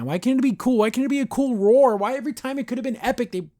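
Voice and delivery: high-pitched, incredulous griping; exasperated complaint. Real, not acted. Reviewer wishes the wah were a roar, exasperated. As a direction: protest in a high, fed-up voice. Complaining scream was not cool.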